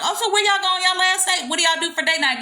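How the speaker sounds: put-on high-pitched, eager girlfriend questions; nosy commenters. Commenters asking about date night, eagerly curious. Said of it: "y'all in the comments will be like".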